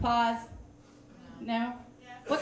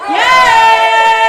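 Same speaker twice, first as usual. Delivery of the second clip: crowd yell; 7 semitones higher, 18 dB louder than usual. Real, not acted. Crowd answers the host.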